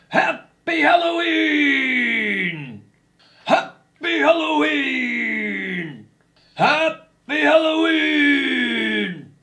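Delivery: loud, slow drawn-out monster howl. Pretend. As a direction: stretch out each word like a monster.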